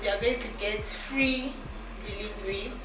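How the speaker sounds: ordinary talk, nothing marked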